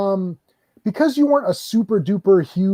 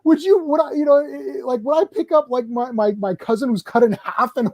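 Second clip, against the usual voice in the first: incredulous retort; 6 semitones higher than usual. Retold or imitated.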